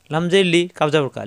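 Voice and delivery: ordinary delivery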